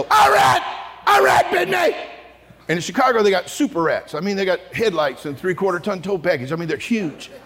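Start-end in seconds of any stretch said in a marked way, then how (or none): 0.0-2.0 high, loud cry of alarm; panicked yell. Retold or imitated. Guy in the sewer to the others, panicked high shout. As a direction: yelp it out in mock panic. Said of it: "one of the guys go".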